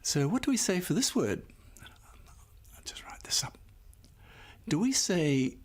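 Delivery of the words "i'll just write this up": whispered aside, breathy and unvoiced. Real, not acted. Teacher thinking aloud to the class, whispering.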